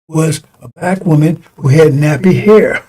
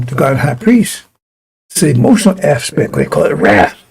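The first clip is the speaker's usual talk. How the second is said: ordinary delivery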